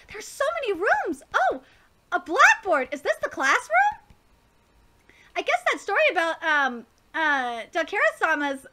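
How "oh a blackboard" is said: ordinary talk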